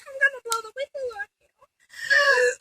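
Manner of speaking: high, crying-edged shout as Jill; hammed-up high shrieking warning; shrill threat. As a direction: scream a threat at the pirate.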